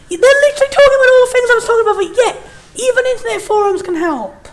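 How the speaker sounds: high, loud and fast amused outburst; excited rush. Real, not acted. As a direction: blurt it out in amused disbelief. Laughing realisation about forums.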